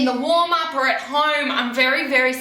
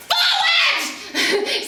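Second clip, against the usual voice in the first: much higher, loud yelled command with a laugh; coaching shout; 4 dB louder than usual. Shown as a demonstration. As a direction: yell it at the rider across the arena. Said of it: "I'm just screaming".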